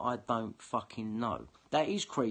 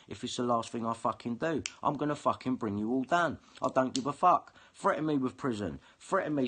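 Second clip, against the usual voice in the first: ordinary delivery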